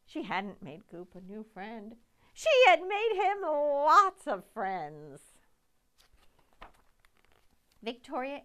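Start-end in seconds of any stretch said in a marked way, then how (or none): 2.3-5.4 high, loud, slow with a laugh; laughing delight. A narrator's reading. Narrator revealing the happy twist, laughing.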